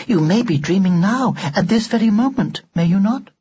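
high, prim and emotionless; an old-fashioned doll speaking without feeling; expressionless doll voice. A narrator's reading. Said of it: "Expressionless, as always".

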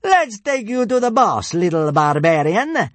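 high loud gloating soldier; centurion voice. A narrator's reading. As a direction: sneer it like a soldier. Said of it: "said the centurion".

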